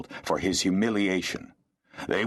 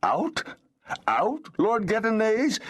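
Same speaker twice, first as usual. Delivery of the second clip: much higher, stunned stammering disbelief; stammering in shock. Acted. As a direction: echo the word in frightened disbelief, pitched way up. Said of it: "Felek stammered".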